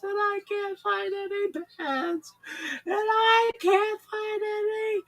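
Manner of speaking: very high, loud, slow crying wail; sobbing caller. Retold or imitated. Caller sobbing to the host, loud slow wail. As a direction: blubber it out in tears.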